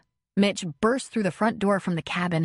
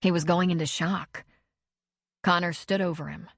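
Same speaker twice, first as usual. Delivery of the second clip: ordinary delivery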